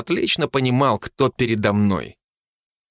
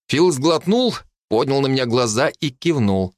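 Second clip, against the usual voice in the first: ordinary delivery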